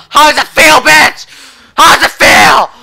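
very high, full-volume shouted taunt; triumphant screaming trash talk. Real, not acted. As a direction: scream it in their face. Escalating taunt.

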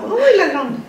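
high, teasing little scold; mock scolding. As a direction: tease him as a thief.

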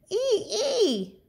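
high squealing ee; pig squeal. Acted. Teacher voicing the pig's high squeal for the class. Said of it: "the pig squeals".

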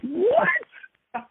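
very high-pitched incredulous squawk; mock-astonished squeal; retold disbelief. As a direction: act out being floored, voice shooting up high. Retelling disbelief at friend's confession.

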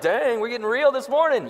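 a teasing imitation of a startled audience; mock congregation gasp. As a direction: play the shocked crowd, hammed-up. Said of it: "Everyone's like".

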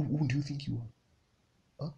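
ordinary talk, nothing marked

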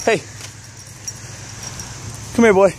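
high call to a dog; calling a lost pet. Real, not acted.

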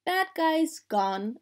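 lightly voiced slow monster; simple monster talk. A narrator's reading. Casey observing the dragons fleeing, lightly voiced monster talk. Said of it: "talking like a monster".

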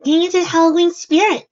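put-on very high, loud girlish voice; Mabel's voice. A narrator's reading. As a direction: pipe up high and loud as an excited kid. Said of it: "said Mabel".

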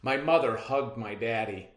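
plain reading voice

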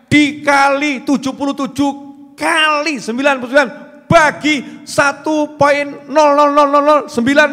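loud, high sermon voice building a long sum; preaching at full force. Acted. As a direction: roll out the huge problem to the crowd. (ceramah berapi-api, lantang dan tinggi)

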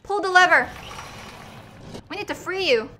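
ordinary talk

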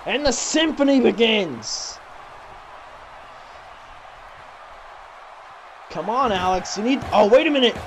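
quick, lively ringside call; live play-by-play. Acted. Commentator calling moves to the viewers at home, brisk play-by-play. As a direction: call the match as it happens.

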